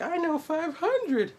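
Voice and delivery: put-on high childish boast; little girl bragging. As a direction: proudly announce it like a small child. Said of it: "And the little girl was like".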